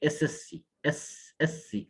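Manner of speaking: এসএসসি spelled out slowly, letter by letter; slow careful SSC. Shown as a demonstration. Teacher models SSC letters slowly for the student. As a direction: drag out each letter of SSC. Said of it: "এস না".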